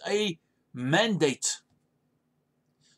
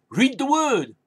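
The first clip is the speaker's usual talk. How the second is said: "Read the word" much higher and louder, punched; emphatic command; 6 semitones higher, 5 dB louder than usual. Shown as a demonstration. Pastor loudly answering the worried believer. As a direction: bark it like a final answer. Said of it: "preacher repeating his command".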